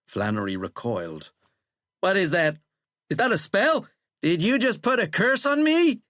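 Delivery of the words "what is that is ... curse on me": high, loud, climbing questions; alarmed. A narrator's reading. President fearing he was cursed, alarmed. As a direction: get more panicked with each question. President recoils at suspected spell.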